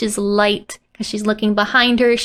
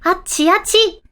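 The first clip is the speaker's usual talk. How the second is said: excited; 6 semitones higher than usual. Acted. Child points excitedly.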